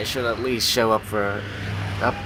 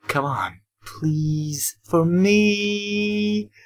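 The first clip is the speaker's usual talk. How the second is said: whiny begging to a friend; pleading; 9 semitones higher, much slower than usual. Acted. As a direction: beg harder on every word.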